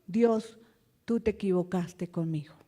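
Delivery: low, soft complaint to God; subdued, acted grievance; quiet reproach to God. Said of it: "cuando nosotros decimos".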